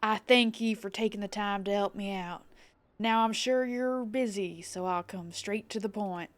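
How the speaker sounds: piratical tavern keeper with a southern twang; pirate accent, confiding a job; Baderon, pirate innkeeper. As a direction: read the quest-giver as a friendly pirate. Reads Baderon's dialogue aloud.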